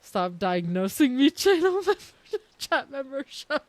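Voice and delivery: high, laughing mock scolding of chat; laughing at chat's diagnosis; laughing protest. Real, not acted. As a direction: scold chat while cracking up. Teasing chat while laughing.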